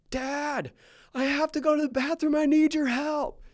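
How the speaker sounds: put-on very high, whiny little kid voice; four-year-old son. Dad imitates his little son begging for help, high child voice. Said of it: "he's like".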